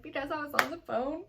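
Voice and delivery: half-embarrassed confession; mock sheepish answer. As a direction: say it like a caught kid. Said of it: "And I said".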